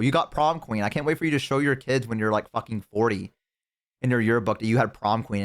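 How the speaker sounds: normal talking voice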